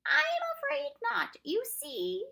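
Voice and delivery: high, loud, slow refusal; Proc's character voice. A narrator's reading.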